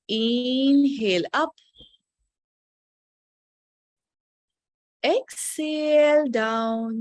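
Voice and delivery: deliberately slow. Shown as a demonstration. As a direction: draw each word out to match the breath. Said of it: "मैं जानबूझ के धीरे बोल रही हूँ".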